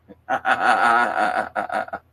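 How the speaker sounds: loud burst of rapid laughter. Real, not acted. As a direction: laugh out loud in a long run of ha-has. Reacts laughing to a chat message.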